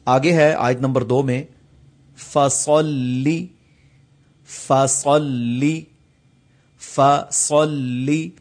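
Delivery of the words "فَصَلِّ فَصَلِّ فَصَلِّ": فَصَلِّ with a heavy, clear ص, drawn out; clear saad. Shown as a demonstration. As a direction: repeat فَصَلِّ slowly, ص thick. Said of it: "یہ صاد یہاں بڑا واضح پڑھنا چاہیے".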